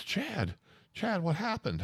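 high, pleading mock urgency; acted high-pitched concern; urgent questioning. Acted anxious question from back seat.

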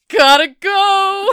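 loud, slow, high yell; shouted exit. Retold or imitated. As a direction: shout it as you flee. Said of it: "the kid's like".

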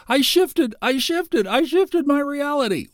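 put-on very high squealing young voice; excited kid voice. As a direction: squeal it like a thrilled teen. TikTok kids voiced.

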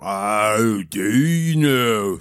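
low, slow, seething giant. A narrator's reading. Leader answers accusation of being crook, seething. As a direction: simmer slowly in a low voice.

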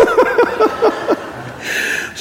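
a real, high, airy burst of laughter; high breathless laugh. Real, not acted. Preacher laughing at his own ranch story, high and breathless. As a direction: break up laughing at the memory.

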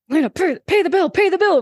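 high, loud, urgent begging; frantic pleading. Retold or imitated. Salon owner begging her friend over the phone to pay, frantic high plea. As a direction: rush it out as if the water depends on it.

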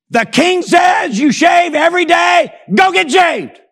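high, loud, slow barked order; drill sergeant shout. Retold or imitated. Inspector shouting at recruit to go shave. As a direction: bark the order in his face.

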